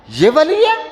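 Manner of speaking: high, jeering voice; mocking accusation. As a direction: sneer at the so-called saint. Woman mocking the saint. (طنزیہ چیختی آواز)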